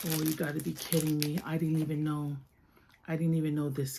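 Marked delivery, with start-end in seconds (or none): none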